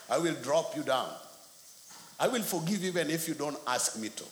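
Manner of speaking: ordinary delivery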